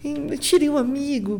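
high, whiny mock plea to his brother; high whining little brother begging for help; whiny Shun impression. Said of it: "a Shun fica incrível".

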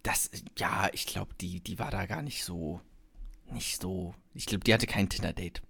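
unvoiced, hushed gossip; whispering. Real, not acted. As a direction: whisper it like a secret. Gossip about friend, whispered.

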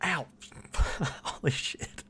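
sudden yelp of pain. Real, not acted. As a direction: react to a knee popping. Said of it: "That was my knee".

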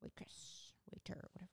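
unvoiced throwaway whisper; whispered aside. Real, not acted. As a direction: toss it off under breath.

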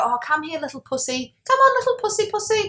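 high, cooing cat call; imitated sing-song pet calling; old person impression. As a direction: coax a cat like an old dear. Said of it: "old people will say the words".

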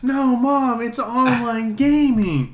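high, slow, whiny; whining kid. Retold or imitated. Kid whining to mom about gaming, high whiny. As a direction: whine it like a kid. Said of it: "kid whining to mom".

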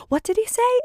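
put-on high, puzzled child's voice; children puzzled by the dog, high kid voice; kids' voice. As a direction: pipe up like a curious little kid. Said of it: "the kids go".

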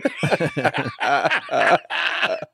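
long high belly laugh; real laughter. Real, not acted. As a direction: burst out in prolonged laughter. Cracks up at own joke.

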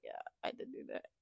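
whispered confession to dad; sheepish whisper. Retold or imitated. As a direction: barely breathe out the admission.

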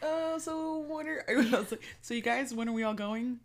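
high and eager; high eager asking. Retold or imitated. Retold eager question to friends.

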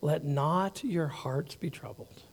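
quiet, slow, soothing reading; soft reassurance. Shown as a demonstration. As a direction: lay the verse down gently. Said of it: "Let's pause there".

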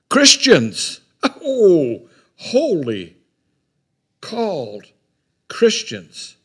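loud, high cry that falls away; preaching burst. Acted. Preacher to congregation, a high, loud cry. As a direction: cry it out, then let it drop.

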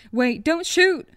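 high, loud terrified plea; frantic shouting hostage taker. Acted. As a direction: scream it, begging for life. Gun in her face, pleading.

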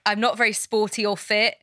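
ordinary talk

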